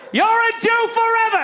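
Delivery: very high, loud acted holler; yelling. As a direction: shout it like a curse on passers-by. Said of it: "he yells to me".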